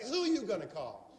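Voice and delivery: slow, pointed and confident; like you mean it. Shown as a demonstration. Speaker demonstrating to the audience how to say it with conviction. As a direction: look someone in the eye and say it. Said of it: "Look at somebody like".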